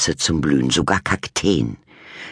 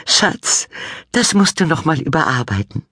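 laughing; 13 semitones higher than usual. A narrator's reading. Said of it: "sagte Mama lachend".

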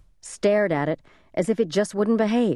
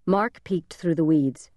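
usual speaking voice

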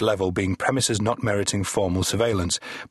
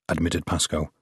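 ordinary delivery, nothing marked

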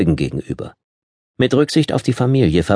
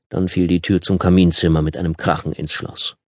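ordinary delivery

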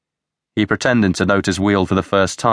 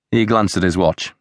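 ordinary delivery, nothing marked

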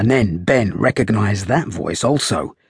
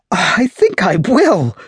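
gasped; 11 semitones higher, 5 dB louder, slower than usual. A narrator's reading. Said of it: "gasped the girl".

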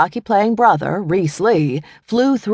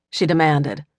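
ordinary delivery, nothing marked

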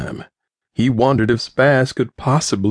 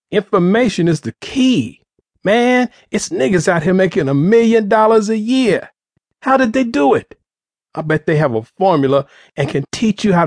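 high, eager rush of ideas; excited; 8 semitones higher than usual. A narrator's reading. Spaz eagerly explaining information to his crew, excited. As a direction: lift it up like a revelation.

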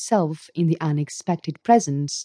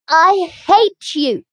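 high, loud and slow, throat tight; choked voice; 11 semitones higher, 6 dB louder, slower than usual. A narrator's reading. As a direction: choke the words out in fury. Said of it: "she cried in a choked voice".

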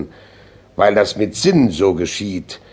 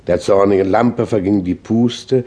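ordinary delivery

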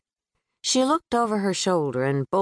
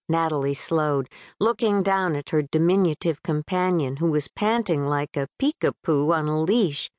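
plain reading voice

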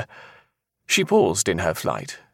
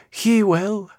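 high seer voice, utterly certain; flat, eerie certainty; 4 dB louder than usual. A narrator's reading. Seer answering the man's denial, eerily certain. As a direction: pronounce it high, as settled fate. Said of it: "Yolanda said flatly, in a voice so eerily certain".

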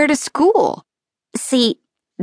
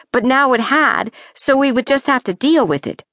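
plain reading voice

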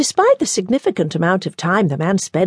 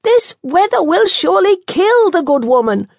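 worried physician; 12 semitones higher, 4 dB louder than usual. Acted. Physician fears for the nun.

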